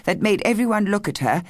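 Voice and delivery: usual speaking voice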